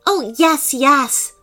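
high, breezy brush-off; dismissive. Acted. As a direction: wave their worries away. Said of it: "dismissing their worries with a wave".

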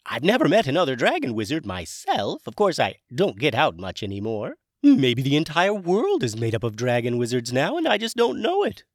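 high, loud dragon character voice; Hamish dragon voice. Acted. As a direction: play a chirpy, high-pitched dragon wizard. Hamish speaks.

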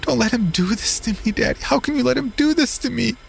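squealing plea. Acted. Daughter squealing at her father to stop the Reverend, high squeal. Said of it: "she squealed".

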